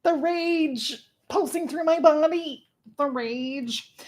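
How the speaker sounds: high, slow, theatrical fury; mock-dramatic rage, slow and high. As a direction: ham up the boiling rage. Reliving book anger, dramatic.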